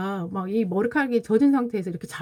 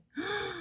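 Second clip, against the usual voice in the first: sudden soft breath in; mother recalling the moment she saw her son, shocked gasp; 10 semitones higher, 12 dB quieter than usual. Retold or imitated. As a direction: catch your breath in shock. Retelling seeing son asleep.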